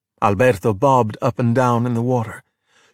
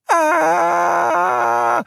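high held scream of fright; scared scream. A narrator's reading. Luca on the falling Vespa, high frightened scream. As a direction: cry out in terror. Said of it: "Luca screamed in fear".

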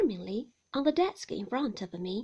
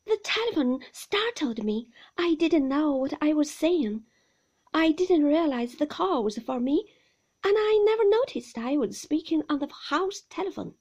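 words tumbling out, louder; stammering apology; 5 semitones higher, 9 dB louder than usual. A narrator's reading. New wife apologising to the housekeeper, flustered and tumbling. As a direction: rush the apology, tripping over words. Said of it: "stammering, my words tumbling over one another".

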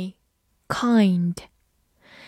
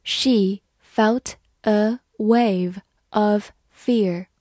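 slow, careful classroom read; slow model reading for learners; much slower than usual. Shown as a demonstration. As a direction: read each word slowly and clearly. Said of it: "好，慢读一遍".

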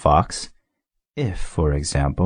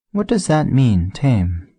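put-on higher, curious boy voice; little prince asking; 6 semitones higher, slower than usual. A narrator's reading. Little prince asking the fox, higher curious voice. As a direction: ask it like a puzzled child. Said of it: "he added".

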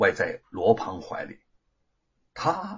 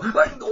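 very high yelp of sudden pain; 4 dB louder than usual. A narrator's reading. Bitten thief yelping in pain, high shriek. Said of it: "那个人顿时疼得大叫".

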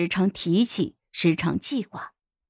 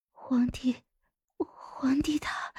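faint breathy whisper, higher; urgent, dying gasp; 4 semitones higher, 10 dB quieter, slower than usual. A narrator's reading. Dying consort asking after the emperor, weak urgent whisper. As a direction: ask urgently with failing breath. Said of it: "迫不及待地问道".

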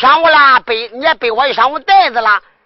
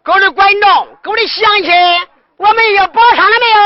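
calling out; 5 semitones higher than usual. Acted. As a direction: hail the whole audience like a barker. Calling out to audience.

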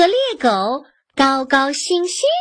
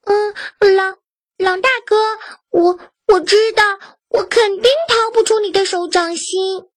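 higher, stammering pretend fright; trembling fear; 7 semitones higher than usual. Goat faking fear to the wolf, higher trembling voice. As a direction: put on a shaky scared voice for the wolf. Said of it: "然后哆哆嗦嗦地说".

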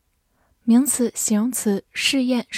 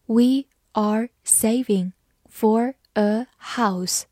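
slow, careful reading pace; slowly; much slower than usual. Shown as a demonstration. Teacher reads the sentence slowly for learners, slow. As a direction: read it out word by word, slowly. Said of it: "好，慢慢来读".

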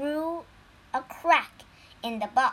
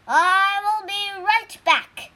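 tiger immortal voice; 8 semitones higher, 7 dB louder than usual. Acted.

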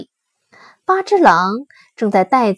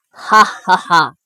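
slow, gloating villain laugh; a low bandit's ha ha ha; villainous laugh; 3 semitones lower, 5 dB louder, slower than usual. A narrator's reading.

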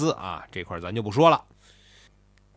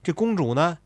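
usual speaking voice

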